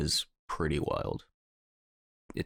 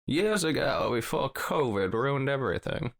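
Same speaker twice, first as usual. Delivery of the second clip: high, put-on villain voice; Bane voice; 8 semitones higher, 3 dB louder than usual. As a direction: growl it like the masked villain.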